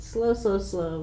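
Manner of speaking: each slow stretched and softening; fading slow, slow, slow; drawn-out slow. Shown as a demonstration. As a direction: let the words slow down like the feet. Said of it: "taking slow circles".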